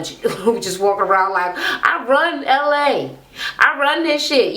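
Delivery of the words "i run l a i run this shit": mock boastful. Mocking Natalie Nunn's strut.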